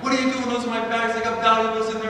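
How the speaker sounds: high, loud furious yell at the porter; angry yelling. Retold or imitated. Traveller yelling at the sky cap over rough handling, furious high shout. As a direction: shout him down over the bags. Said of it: "He starts yelling at the sky cap".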